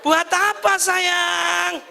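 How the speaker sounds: surprised husband. Pretend. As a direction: yelp it in mock alarm. Husband startled by three basins.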